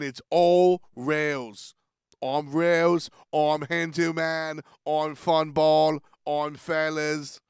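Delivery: loud, drawn-out mock English accent; one-size-fits-all English accent. Shown as a demonstration. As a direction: announce each name in a broad English accent. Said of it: "one accent for all English people".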